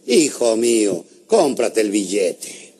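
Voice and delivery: low, slow voice from above; solemn heavenly voice, deadpan punchline; voice of God. Acted. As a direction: drop it deep and draw it out. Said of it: "una voz que le dijo".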